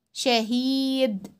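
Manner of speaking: شهيد with a held vowel; long ii in شهيد. Shown as a demonstration. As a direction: stretch the ii of شهيد. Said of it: "بيطول صوته ازاي".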